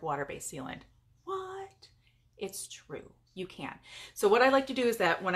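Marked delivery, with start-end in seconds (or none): 1.2-1.9 high, soft incredulous squeal; mock disbelief. Plays a shocked listener. 2.4-3.7 soft, half-whispered reassurance; confiding hush. Pretend. Answers her own mock shock.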